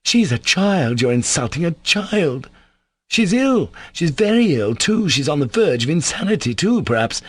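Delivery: high-pitched, urgent pleading; distressed protest. A narrator's reading. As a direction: plead for her, pitch leaping up. Alyosha protests in grief at Ivan.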